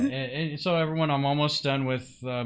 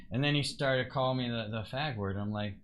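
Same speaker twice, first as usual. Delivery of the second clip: normal talking voice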